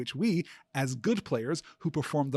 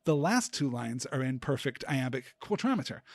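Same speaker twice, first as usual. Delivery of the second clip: normal talking voice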